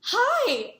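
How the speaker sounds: high, loud cheerful greeting; bright excited hi. Retold or imitated. Singer greeting her fan with an excited hi. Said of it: "And she goes".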